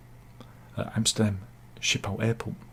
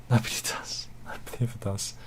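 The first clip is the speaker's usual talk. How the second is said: soft whisper with a laugh; 2 semitones lower, 9 dB quieter than usual. Real, not acted. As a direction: whisper it to yourself. Whispered sad repeat.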